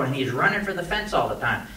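ordinary talk, nothing marked

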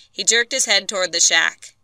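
plain reading voice

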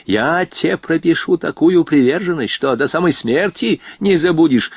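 high-pitched angry threat; officer's temper erupting; boiling over. A narrator's reading. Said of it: "вскипел офицер".

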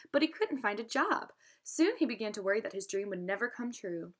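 plain reading voice

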